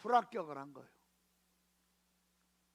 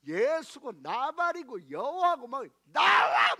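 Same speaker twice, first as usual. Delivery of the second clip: slow, loud cursing yell at the cross; retelling a furious woman yelling at the cross; drunken cursing shout; 4 semitones higher, 7 dB louder, slower than usual. Retold or imitated. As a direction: bellow the curse slowly, defiant. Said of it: "막 욕하니까".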